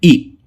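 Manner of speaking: ई said short and light; the short ई vowel, demonstrated slowly; short i sound. Shown as a demonstration. Teacher demonstrating the short vowel. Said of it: "छोटी ई का sound".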